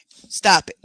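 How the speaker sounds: usual speaking voice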